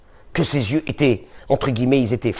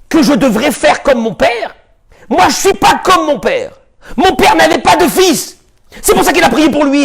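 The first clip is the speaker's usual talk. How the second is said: high, raised angry retort; 7 semitones higher than usual. Acted. Yaakov snapping back at his wife Rachel: raised angry voice. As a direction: throw the retort back at her.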